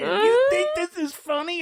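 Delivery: high-pitched mock scold; mock angry girlfriend. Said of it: "she got mad at, more mad at him".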